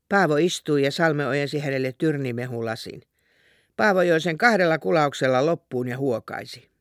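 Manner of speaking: usual speaking voice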